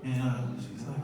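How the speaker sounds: ordinary delivery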